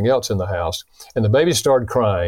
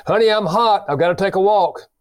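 much higher-pitched quoted husband; urgent retold warning; 9 semitones higher than usual. Husband warns wife before losing his temper, urgent retold warning. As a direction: lift the pitch right up, imitating a heated husband.

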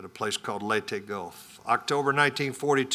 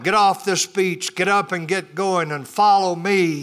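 rallying yell; 5 semitones higher, 4 dB louder than usual. Retold or imitated. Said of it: "and yelled".

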